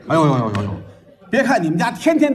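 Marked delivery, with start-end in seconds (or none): 0.1-0.7 mock dismay wail. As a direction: groan it out as if caught. Feigned dismay.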